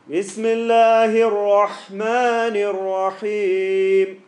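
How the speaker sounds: loud, high, very slow chant; lazy recitation. Shown as a demonstration. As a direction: chant it long and lazily, with full voice. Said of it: "Can you see it's lazy?".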